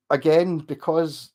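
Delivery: ordinary delivery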